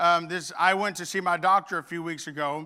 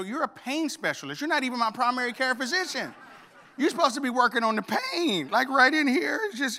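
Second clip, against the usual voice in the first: indignant protest; 8 semitones higher than usual. Retold or imitated. Pastor retelling how he protested to his doctor, indignant. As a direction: push back in mock outrage. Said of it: "I go".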